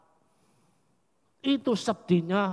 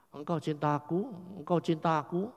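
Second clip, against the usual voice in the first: soft repeated question; 2 semitones lower, 6 dB quieter than usual. Retold or imitated. Jesus asks Peter softly.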